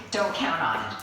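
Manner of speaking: ordinary delivery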